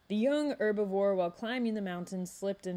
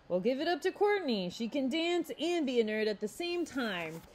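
teasing; 6 semitones higher than usual. A narrator's reading. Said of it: "Xavier teasingly said".

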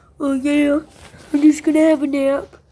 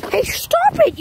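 voice jumping high; 13 semitones higher than usual. Acted. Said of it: "making me talk like that".

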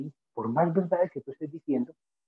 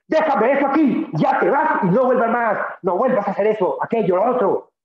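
firm loud scolding; 9 semitones higher, 9 dB louder than usual. Shown as a demonstration. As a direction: speak strongly but from the heart. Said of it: "Cuando tengas que hablar fuerte".

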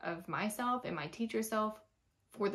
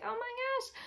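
mock panic; 3 dB quieter than usual. Teacher hearing they're coming down the hallway, mock panic. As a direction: squeal it in retold panic.

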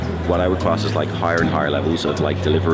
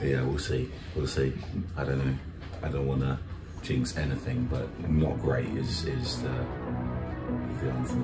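quiet, strained hoarse voice; 3 semitones lower, 7 dB quieter than usual. Real, not acted. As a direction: save a failing voice, speak softly. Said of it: "Not great felt like an understatement".

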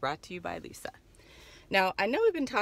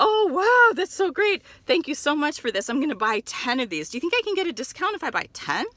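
gushing customer; 8 semitones higher, 6 dB louder, faster than usual. Acted. Naive customer asking for discount.

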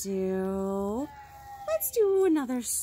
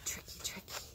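much softer, unvoiced whisper; whispered gasp; 14 dB quieter than usual. Reading to kids beside sleeping squirrel, hushed whisper. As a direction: hush so you don't wake him.

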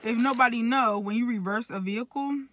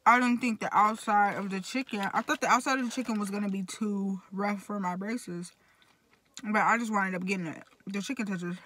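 chewing while talking; eating and speaking; mouth full. Real, not acted. Seen 1: puts food in mouth at 1.7-2.2, then keeps talking.

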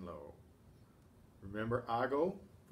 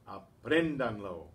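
Apréndanlo slow and loud, PREN punched; stressed second syllable; 7 dB louder than usual. Shown as a demonstration. Teacher showing where the accent falls, Apréndanlo stressed on "prén". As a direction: lean hard on the "prén" in Apréndanlo. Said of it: "to keep the stress where it should be".

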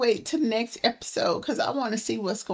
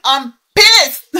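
loud, real angry outburst; pissed off; 3 semitones higher, 12 dB louder than usual. Real, not acted. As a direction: burst out with it, fuming.

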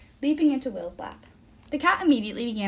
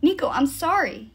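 raised, pleading apology; hasty, loud and higher; urgent apology; 6 semitones higher, 6 dB louder than usual. A narrator's reading. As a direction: blurt the apology out. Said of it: "he said again quickly".

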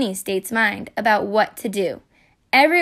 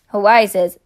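ordinary delivery, nothing marked

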